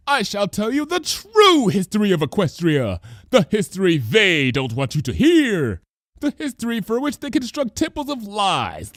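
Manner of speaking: high, theatrical conspiracy voice; hammed-up grand declaration; dramatic cry. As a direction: proclaim it like a caped prophet. Lyra's conspiracy speech.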